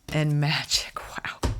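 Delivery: hushed, whispered in wonder; whispering in awe at the card; awed whisper. Real, not acted. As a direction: breathe it out like a secret.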